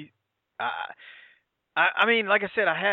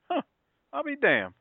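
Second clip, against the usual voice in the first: much higher amazed I'll be damned; 14 semitones higher than usual. Retold or imitated. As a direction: react in pitched-up disbelief. Retold reaction seeing the dive.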